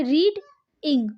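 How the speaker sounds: रीड, then इंग, with a clear gap; split into syllables. Shown as a demonstration. Teacher showing students what we call it, syllable by syllable. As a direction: break the word as रीड / इंग. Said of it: "इसे हम क्या कहेंगे".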